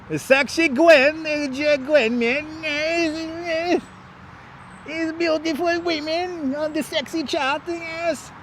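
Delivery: put-on high, slow Russian accent. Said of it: "I get the accent just right".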